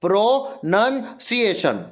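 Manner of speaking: प्रोनंसिएशन slowly in pieces, "pro - nun - ciation"; broken-up प्रोनंसिएशन, no "ow" sound; correct pronunciation. Shown as a demonstration. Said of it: "प्रोनन होता".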